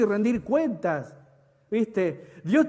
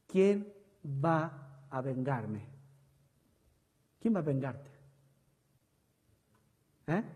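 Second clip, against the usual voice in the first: much softer, lower, slow; a preacher lowering his voice to ask the crowd; hushed question; 6 semitones lower, 11 dB quieter than usual. Real, not acted. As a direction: draw them in with a low question. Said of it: "rhetorical hushed question".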